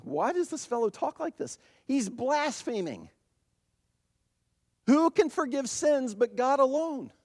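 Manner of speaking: high-pitched, affronted questioning; indignant accusation. Acted. Voicing the teachers' indignant thoughts.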